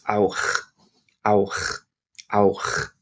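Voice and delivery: auch ending in a rough throaty ch; throat-clearing auch. Shown as a demonstration. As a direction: scrape the ch of auch from the back of the throat. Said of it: "here we're making a ch sound".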